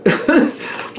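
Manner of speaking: laughing it off under her breath; breathy laugh. Real, not acted. After admitting she got bent out of shape, airy laugh.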